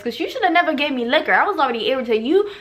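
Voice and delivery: ordinary talk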